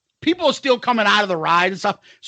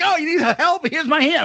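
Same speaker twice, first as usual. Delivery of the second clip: higher-pitched imitation of a helper; mock helpful attendant; 8 semitones higher than usual. Lady offers the stuck man her hand, mock helpful attendant. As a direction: mimic an over-eager offer of help.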